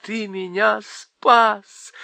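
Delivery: queen thanking the faun, overjoyed; grateful queen. A narrator's reading. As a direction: thank him with delight. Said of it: "сказала королева".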